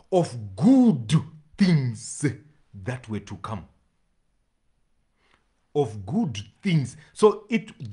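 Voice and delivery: loud, rising preacher's cry on good things; preaching at full force. Real, not acted. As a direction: build the preaching up and hammer it home. Preacher building emphasis.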